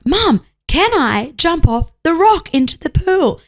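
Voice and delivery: put-on high, slow child voice; insistent little girl. Daughter repeating her request to mum, slow high child voice. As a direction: spell it out slowly as a kid. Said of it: "And she's like".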